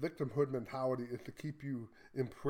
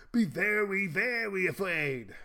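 higher, drawn-out mock-ominous warning; mock scare warning; 5 semitones higher, 3 dB louder, slower than usual. Host mimicking media that wants you afraid, mock-ominous. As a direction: draw it out like an exaggerated scare warning.

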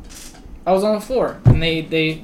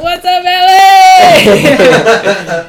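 put-on high, slow and loud drunken call; drunk drawn-out greeting; 11 semitones higher, 10 dB louder, much slower than usual. Drunk son teasing sister Allie, slow loud sing-song call.

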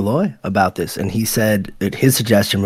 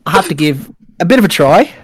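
Australian accent; 4 semitones higher than usual. Shown as a demonstration. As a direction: try an Australian accent, slightly raised. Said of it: "for my Australian".

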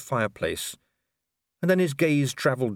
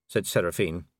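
plain reading voice

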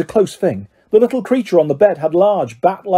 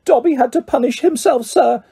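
high, contrite little elf; Dobby, house elf; 8 semitones higher than usual. A narrator's reading. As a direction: explain humbly in a high creature voice. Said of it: "said the elf".

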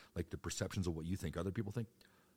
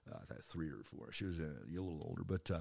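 ordinary talk, nothing marked

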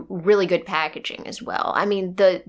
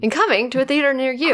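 quick, higher, upbeat promo tag; happy trailer voiceover; 5 semitones higher, much faster than usual. Shown as a demonstration. Showing how the trailer ends, raised and happy. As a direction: raise your voice and sound happy. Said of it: "you raise your voice and you sound happy".

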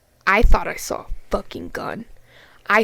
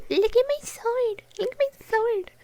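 high mocking voice. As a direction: mimic a clueless kid. Mocking friend's oblivious voice.

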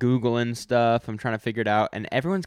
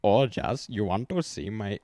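mock Indian accent; 2 semitones lower, 3 dB quieter than usual. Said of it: "it just goes Indian".

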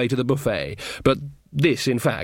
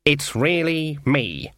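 tender wonder; much slower than usual. Acted. Ridcully to Granny, slow and tender. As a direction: linger on every word.

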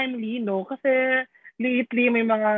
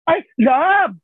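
loud exclamation; 5 semitones higher, 6 dB louder than usual. Real, not acted. Reacting to painful memory.

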